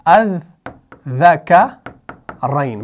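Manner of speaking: अअज़्ज़करैन with the opening vowel held very long and slow; stretched hamza pronunciation. Shown as a demonstration. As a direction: draw out the first syllable of अअज़्ज़करैन like a recitation. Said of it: "असल में ये लफ्ज है ऐसे".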